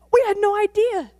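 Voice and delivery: very high and loud; astonished. Retold or imitated. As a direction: exclaim it together. Both shocked.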